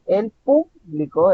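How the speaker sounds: "el público" with PÚ hit hard; stress on first syllable. Shown as a demonstration. Teacher pointing out the tonic syllable, PÚ stressed in "público". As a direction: lean on the PÚ of "público". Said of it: "está en la primera sílaba".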